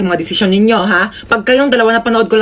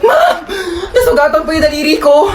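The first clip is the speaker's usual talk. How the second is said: high, crying plea for help; hammed-up pained crying; tearful wail; 5 semitones higher than usual. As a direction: wail it like a hurt student. Student wails over a cut finger.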